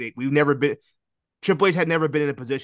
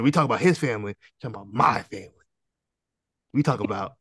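imitating the Rock; 4 semitones lower than usual. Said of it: "he said".

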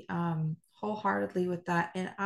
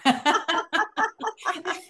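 real laugh; 10 semitones higher than usual. Real, not acted. Laughs at Joy quote.

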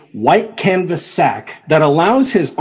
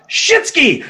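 shouted exclamation; 10 semitones higher than usual. Retold or imitated. Reenacting the opening line.

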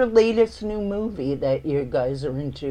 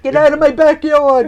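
mock yell; 7 semitones higher, 10 dB louder than usual. Mock rancher line, laughing.

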